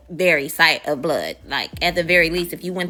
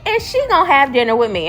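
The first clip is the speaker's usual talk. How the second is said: raised, louder imitation of a boss; mock commanding, pitched up; bossy orders; 5 semitones higher, 5 dB louder than usual. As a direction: lay down the law as the boss. Boss giving orders, acted.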